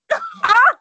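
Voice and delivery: high burst of laughter; loud laugh. Real, not acted. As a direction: crack up loudly.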